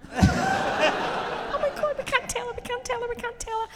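high hushed mock horror; horrified parents. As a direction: gasp in stunned horror. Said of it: "me and her dad are like".

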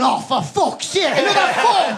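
rising laughing exasperated complaint; mock exasperation. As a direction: wail it like an overworked fisherman. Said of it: "it's me just going".

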